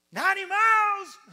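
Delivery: very high, loud shriek; outraged disbelief. Acted. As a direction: screech it back as an appalled wife. Acted wife's outraged echo.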